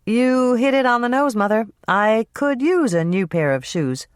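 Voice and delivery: a touch of eager agreement; Hannah agreeing. Acted. Daughter accepting new shoes from mother, lightly voiced.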